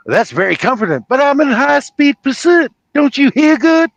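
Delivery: exasperated sheriff climbing to a high shout; Jackie Gleason. Acted. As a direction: build from impatience to loud bluster. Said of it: "I'm pretty good at doing Jackie Gleason".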